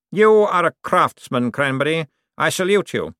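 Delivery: condescending lord to servant; Cosmo's voice. Acted. To his butler, Cosmo's lofty patronizing voice.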